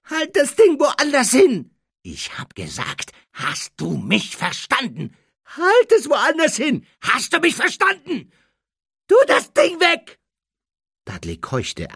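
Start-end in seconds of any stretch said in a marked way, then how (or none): 0.1-1.7 very high, loud frightened cry; shrill scared order; panicked shout. Acted. Dudley scared of the wand. 2.0-5.2 tense, controlled menace; angry insistence. Acted. Harry repeats his threat. 5.5-10.2 very high, loud frightened shouts; frantic high voice; panicked yelling. Acted. Dudley panics at the wand.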